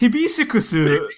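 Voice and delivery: high-pitched mock Japanified English word; over-Japanify the word, pitched high; mock Japanese accent. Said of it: "like, Japanify it".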